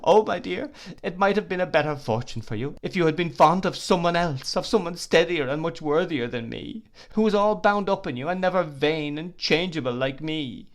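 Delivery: high, loud, anguished weeping; crying out. Acted. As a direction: cry out her unworthiness. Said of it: "she cried".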